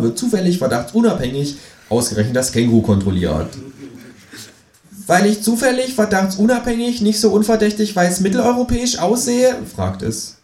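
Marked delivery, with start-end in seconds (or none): 5.1-9.6 high, sharp kangaroo voice; cheeky high kangaroo. A narrator's reading. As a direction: pitch the kangaroo up and sassy.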